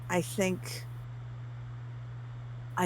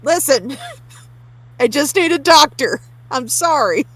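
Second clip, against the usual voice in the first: desperate plea; 8 semitones higher, 10 dB louder than usual. Acted. Pleading with room for doctor.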